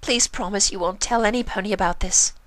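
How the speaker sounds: high, desperate pony voice; pleading Rarity. Acted. Rarity pleading with Pinkie, high and desperate. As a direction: beg her to keep it secret.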